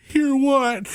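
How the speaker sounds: high, small hear what; innocent kid. Retold or imitated. Daughter feigning innocence to her father, high kid voice. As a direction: play dumb like a little kid. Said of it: "And she went".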